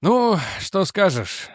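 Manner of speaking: slow, tired half-smiling question; drawn out, worn out but kind; weary smile. A narrator's reading. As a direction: Reggie back home, wearily greeting the sergeant. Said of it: "устало улыбнулся Реджи".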